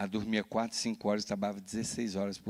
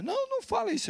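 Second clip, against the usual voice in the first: much higher, mock pleading wife; 3 dB louder, faster than usual. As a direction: squeal a desperate mother's plea. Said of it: "minha mulher". (imitando a esposa aflita, bem aguda)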